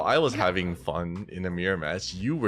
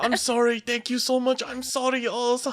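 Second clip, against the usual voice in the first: mock frantic apologizing. Host mocks Ashton apologizing to Ka, high whimpering parody. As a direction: ham up a panicked over-apologizer. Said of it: "doing your best Jackie Chan impression".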